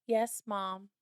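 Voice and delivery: softly imitated obedient child; meek compliance. Daughter agreeing to get homework out, imitated quiet compliance.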